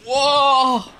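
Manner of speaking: ordinary talk, nothing marked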